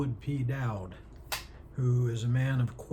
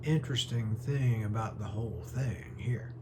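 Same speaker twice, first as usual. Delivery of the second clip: Elwood, genial storyteller; 2 semitones higher than usual. Acted. Elwood answering the doctor about the rabbit's name, genial storytelling. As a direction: play an eccentric gentleman recounting a curious tale, pitch often lifting. Performs the Harvey monologue as Elwood.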